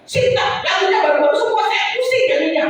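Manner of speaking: mock complaint. Churchgoer protesting the new songs after service, mock complaining. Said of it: "udah keluar protes".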